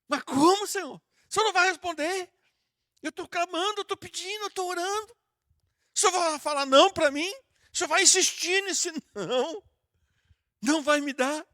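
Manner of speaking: high, pleading complaint to God; arguing with God. Retold or imitated. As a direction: protest like a believer who was refused. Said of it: "a gente briga com Deus".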